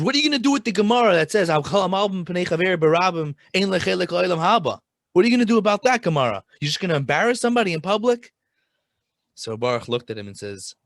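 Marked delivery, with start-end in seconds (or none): 0.0-8.3 high, loud and fast indignant challenge; heated confrontation. Retold or imitated. Son-in-law confronting the Rebbe over public shaming, loud, fast and high. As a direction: fire the Gemara at him, outraged.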